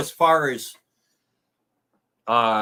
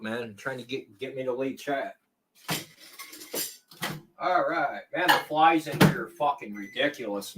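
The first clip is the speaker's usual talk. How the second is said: ordinary delivery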